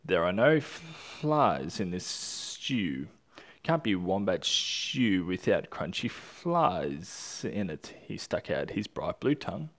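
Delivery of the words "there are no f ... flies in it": slow lizard drawl. Acted.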